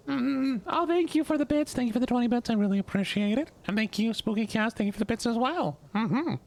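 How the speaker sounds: very high falsetto thank-you; high girly voice. Pretend. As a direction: stay up in the squeaky mock-feminine register. Keeps high girl-voice attempt for thanks.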